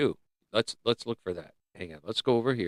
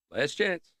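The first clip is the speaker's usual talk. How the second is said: ordinary talk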